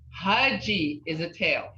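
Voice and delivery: ordinary talk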